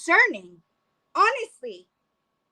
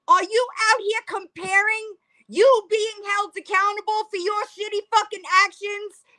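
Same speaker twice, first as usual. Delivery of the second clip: ordinary talk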